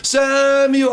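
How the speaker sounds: high, long-drawn call. Acted. God calls to sleeping Samuel in the night, long high call. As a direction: stretch the name out on a high, held note. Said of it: "I know that voice".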